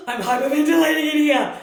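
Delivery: mock-panicked outburst from a locked room. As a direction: play it like the walls are closing in.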